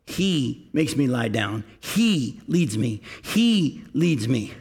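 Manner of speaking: slowly weight each "He"; stress on he. Shown as a demonstration. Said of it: "listen to how David says".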